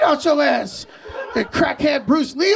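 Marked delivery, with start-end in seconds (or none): none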